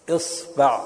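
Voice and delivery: "إصبع" as isba', i then a; kasra on hamza. Shown as a demonstration. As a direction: say "إصبع" with i first and a after the b. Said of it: "إذا كسرت الهمزة فتقول". (نطق "إصبع" بكسر الهمزة وفتح الباء)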